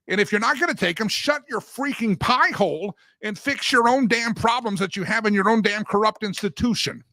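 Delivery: angry outburst; worked up. Real, not acted. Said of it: "I just got worked up today".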